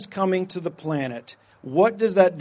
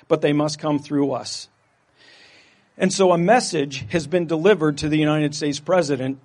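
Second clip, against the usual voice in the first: ordinary delivery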